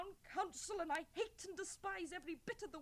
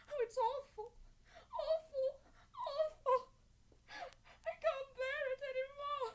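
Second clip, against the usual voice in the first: high, loud, broken sobbing through the words; 7 semitones higher, 4 dB louder than usual. Acted. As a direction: wail it out in despair, voice cracking. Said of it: "Don't cry, child".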